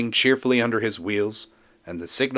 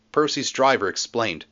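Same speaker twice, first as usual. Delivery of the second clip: usual speaking voice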